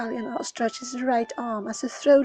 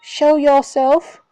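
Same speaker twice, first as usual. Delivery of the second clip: loud, demanding calls; loud, frantic calling; 13 dB louder than usual. Acted. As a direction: shout into the snow for the ghost. Calion calls out to the ghost.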